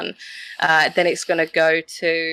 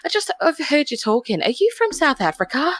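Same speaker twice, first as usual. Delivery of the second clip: higher, quick curious lady; imitating a lady at work; 8 semitones higher than usual. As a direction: play the nosy coworker guessing her accent. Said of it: "They went".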